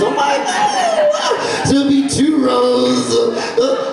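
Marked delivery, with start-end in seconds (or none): 0.4-1.6 high squeal of wonder; hammed-up amazed gasp of joy; acted delighted surprise. Learns they are from old parish.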